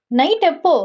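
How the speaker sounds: high-pitched, pestering follow-up; insistent questioning. Acted. Wife keeps pressing husband. (நச்சரிக்கும் உயர்ந்த குரல் கேள்வி)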